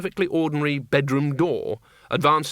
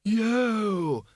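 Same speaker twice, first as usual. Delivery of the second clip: put-on high, drawn-out old wizard voice; thin-volume, high whoop; Windlepoons; 9 semitones higher than usual. A narrator's reading. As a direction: sing out a long, high call. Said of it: "said Windlepoons".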